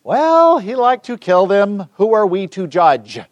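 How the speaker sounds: high, slow, drawn-out mock dithering; mock nonjudgmental. Preacher parodies a relativist excusing Hitler, mock nonjudgmental. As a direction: stretch it out high, feigning reluctance to judge.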